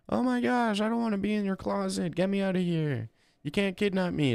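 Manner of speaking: high mock whiny complaining voice; whiny woman imitation. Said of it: "They always start complaining".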